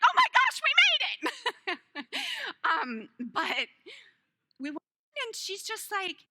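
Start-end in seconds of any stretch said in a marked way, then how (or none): 0.0-2.0 much higher and louder, then slowing down; mock triumphant exclamation; relieved cry. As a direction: burst out with overdone relief. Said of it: "And I'm like".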